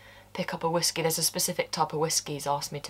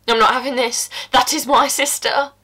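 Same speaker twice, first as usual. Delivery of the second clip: furious; 5 semitones higher, 7 dB louder than usual. Acted. Defending the sister, furious. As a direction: stress not and refuse it flat out.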